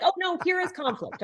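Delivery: high, hammed-up dismay; mock alarm. Coach mocking a dismayed team member, hammed-up high alarm. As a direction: act alarmed, tongue in cheek.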